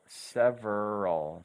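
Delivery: "several" stretched slowly, sev-er-al; slow syllable demo. Shown as a demonstration. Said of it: "you double the V and you double the R".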